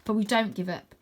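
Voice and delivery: ordinary delivery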